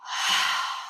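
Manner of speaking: long, soft sigh; slow exhale of release; releasing sigh. Shown as a demonstration. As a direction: breathe it all out in a sigh. Demonstrates releasing into the body.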